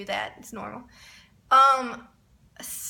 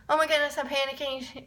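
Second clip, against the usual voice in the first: ordinary talk, nothing marked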